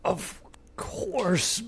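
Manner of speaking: soft whispered mystic tone; mystical voice. Acted. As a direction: breathe it like an oracle. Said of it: "mystical voice".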